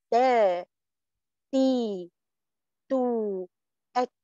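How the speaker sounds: ta, ti, tu, at with the tongue tip on the ridge; Arabic ta with vowels. Shown as a demonstration. تجويد: نطق التاء بحركاتها. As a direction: clean dental t on each syllable, no hiss. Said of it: "أقول".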